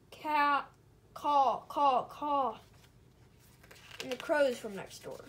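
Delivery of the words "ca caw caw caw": loud mock caw; cawing call. Neighbors calling across barnyard, loud caw. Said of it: "the cows from next door called".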